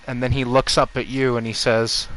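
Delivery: ordinary talk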